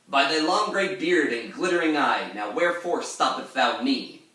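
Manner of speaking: put-on high, loud theatrical recitation of the old verse; dramatic reading. Shown as a demonstration. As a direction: declaim it like a stage actor. Teacher reads the poem's quote dramatically.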